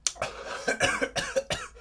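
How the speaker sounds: harsh choking coughs; coughing hard from the spice; coughing fit. Real, not acted. Choking on spicy soup.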